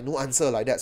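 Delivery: ordinary delivery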